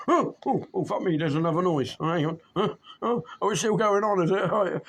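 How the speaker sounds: high, jumpy startled listener voice. Retold or imitated. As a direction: play a dozing listener jolted awake. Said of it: "'Cause like".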